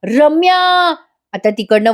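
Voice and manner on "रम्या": retold yell. Wife yelling at the woman who tore her toy, mock shout. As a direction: call the name out in pretend outrage. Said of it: "मोठ्याने ओरडते".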